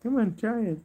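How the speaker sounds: high coaxing pet voice; sing-song sweet talk to a little animal. Real, not acted. Coaxing her guinea pig to eat carrot, in high baby talk.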